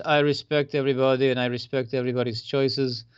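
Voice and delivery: light attempt at a Russian accent; a hint of a Russian accent on the quote. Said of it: "That's my attempt at a Russian accent".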